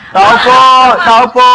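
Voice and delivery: loud call at the door; calling from far away. Acted. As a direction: call out to the house.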